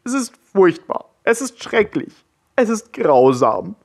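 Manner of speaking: high, anguished, tearful lament; wailing lament. A narrator's reading. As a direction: wail over the heartbreak. Heartbroken troll laments. (verzweifelt klagend)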